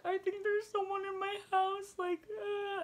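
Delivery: high-pitched mock crying voice; hammed-up distressed whine; imitated tearful panic. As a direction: squeak it out as if in tears. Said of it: "why are you crying".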